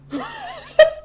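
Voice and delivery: sudden squeaky high laugh; high giggle. Real, not acted. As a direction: giggle at his own line. After his Casey line.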